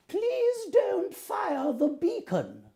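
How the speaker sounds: high, drawn-out queenly voice; slowly, in a high regal voice; Queen of England. Shown as a demonstration. Showing Tim the Queen's line, high queenly voice. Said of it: "be the Queen of England".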